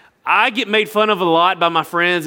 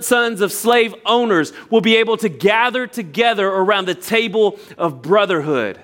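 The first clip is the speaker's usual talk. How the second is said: impassioned oratory; 4 semitones higher than usual. Shown as a demonstration. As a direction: deliver it like a great civil rights speech. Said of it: "way less impactful than saying".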